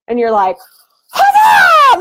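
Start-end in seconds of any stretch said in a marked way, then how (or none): 1.1-2.0 loud, high, exultant victory cry; a mom whooping as a new coach signs up; triumphant whoop. Acted. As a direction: cheer it like you just won. Mom celebrating a new coach signup.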